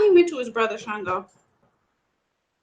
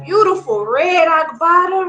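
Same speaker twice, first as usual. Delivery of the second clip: usual speaking voice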